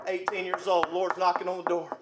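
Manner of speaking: ordinary talk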